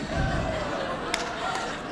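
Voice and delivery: soft breathy laugh, high and quiet; real laugh. Real, not acted. Laughing at the irony of sharing a cab with a defense contractor.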